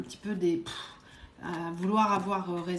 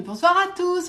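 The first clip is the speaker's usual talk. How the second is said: bright, much higher, louder hello to the chat; lively greeting; 9 semitones higher, 6 dB louder than usual. Real, not acted. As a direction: greet the whole live audience with energy. Livestream greeting to viewers. (salut enjoué et fort)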